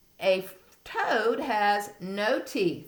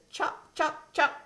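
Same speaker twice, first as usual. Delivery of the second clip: higher clipped chops; tree frog call; 8 semitones higher than usual. Shown as a demonstration. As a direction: snap out "Chop" like a little frog. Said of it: "A red-eyed tree frog says".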